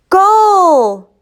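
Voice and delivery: high, loud cheering shout; crowd cheer. Acted. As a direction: roar it like a whole stadium. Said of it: "the crowd cheered".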